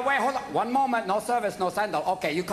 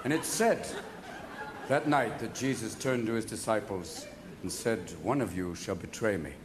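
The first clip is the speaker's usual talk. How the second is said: solemn Jesus voice; 13 semitones lower, 6 dB quieter than usual. Acted. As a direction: intone it like a church reading. Mock biblical Last Supper telling.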